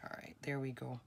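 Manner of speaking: whispered under the breath, voiceless. Real, not acted. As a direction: breathe it to yourself as the piece settles. Quiet aside while gluing.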